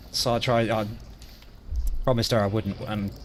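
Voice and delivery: ordinary talk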